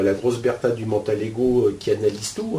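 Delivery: ordinary delivery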